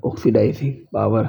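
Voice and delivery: usual speaking voice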